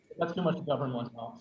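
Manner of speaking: ordinary talk, nothing marked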